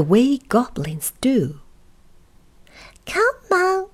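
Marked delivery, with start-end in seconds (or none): 3.1-3.9 very high goblin call; goblin calling back. Acted. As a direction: call over your shoulder as a little goblin. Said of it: "he called over his shoulder".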